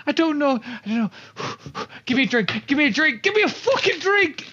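scared rant. Acted. Terrified adventurer begging the barkeeper for a drink, frantic. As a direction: spill it out in terror, desperate for a drink. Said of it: "and I'm scared".

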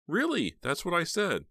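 very high, loud, alarmed; very high alarmed protest; alarmed insistence. A narrator's reading. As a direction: protest in disbelief.

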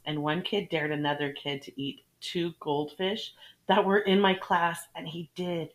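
ordinary talk

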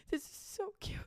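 soft, high, voiceless squeak; imitated tearful gushing. Imitating friend at nursery songs, mock tearful. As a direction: squeeze it out as if choking up. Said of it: "she just starts crying again".

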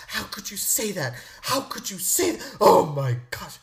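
mock appalled family outburst; freaking out. Family condemning him for his remark, mock freak-out. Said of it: "They're freaking out at me".

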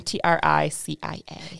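T R I C I A, letter by letter; spelling it out. Shown as a demonstration. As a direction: spell T R I C I A one letter at a time. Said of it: "my middle name".

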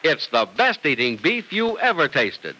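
ordinary talk

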